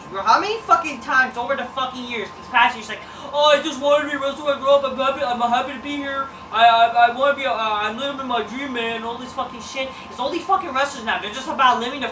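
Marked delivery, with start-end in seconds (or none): 3.3-9.0 fast, louder eager rookie gush; mocking wrestler. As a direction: parody a starry-eyed newcomer.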